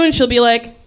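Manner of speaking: ordinary talk, nothing marked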